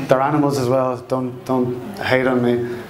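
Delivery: ordinary talk, nothing marked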